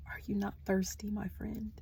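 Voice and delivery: hushed, fast whisper of a heard voice; whispered message. Retold or imitated. Reader repeating a spirit's message, whispering. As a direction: breathe the message like a secret. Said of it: "I heard".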